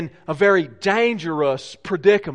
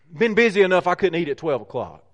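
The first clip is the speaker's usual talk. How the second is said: ordinary delivery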